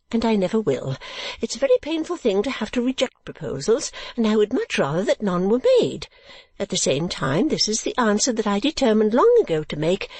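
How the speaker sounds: high-pitched, self-important lady refusing a suitor; Mrs Nickleby, protesting. Acted. Lady rebuffing her suitor before her daughter, primly protesting. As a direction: pitch it high and lively, solemnly declining.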